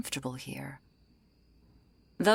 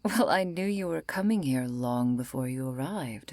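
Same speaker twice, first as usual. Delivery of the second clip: usual speaking voice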